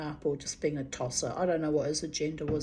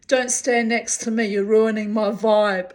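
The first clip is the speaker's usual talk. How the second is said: loud, mock-annoyed brush-off; retold telling-off; 3 semitones higher, 8 dB louder than usual. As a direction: shoo him away loudly.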